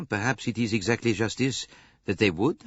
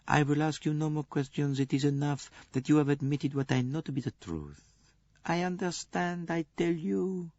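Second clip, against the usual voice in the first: Poirot gently consoling the nurse; gentle comforting; 8 semitones higher than usual. A narrator's reading. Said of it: "continued to pat her gently".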